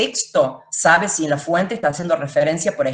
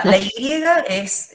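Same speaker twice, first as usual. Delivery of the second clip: slowed down, dwelling on "la Y es" before naming its sound; slow explanation; 4 semitones higher, much slower than usual. Shown as a demonstration. Teacher introducing the sound of Y to students, slowly. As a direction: stretch out "la Y es" as if about to demonstrate. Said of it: "como ese sonido medio zzz eléctrico".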